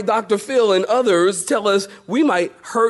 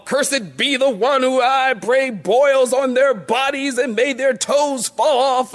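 higher, hammed-up curse from an angry mother; mock cursing mom; 6 semitones higher than usual. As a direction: call down the curse like an outraged mom. Mom pronouncing curse on thief.

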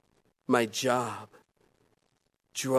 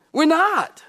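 "We're not." higher and louder, punched; emphatic repeat; 9 semitones higher, 4 dB louder than usual. Acted. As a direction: hammer the point home.

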